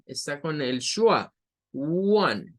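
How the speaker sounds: usual speaking voice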